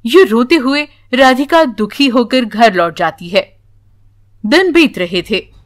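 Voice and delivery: ordinary delivery, nothing marked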